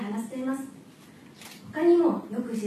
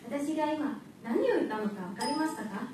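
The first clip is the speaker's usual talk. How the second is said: usual speaking voice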